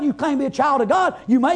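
usual speaking voice

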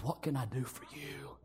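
low, very soft official voice; hushed judge. Retold or imitated. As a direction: play the judge, low and nearly under the breath. Acting the quiet judge.

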